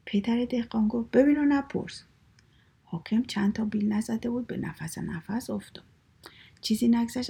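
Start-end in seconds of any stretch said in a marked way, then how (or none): 1.1-2.0 higher, louder brush-off refrain; acted dismissive order; curt storybook command. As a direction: shut the question down, playfully firm. Father's curt refrain to ruler.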